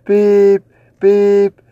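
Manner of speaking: quick electronic beep impression; imitated beep. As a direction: mimic the microwave going off. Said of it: "I hear this beep".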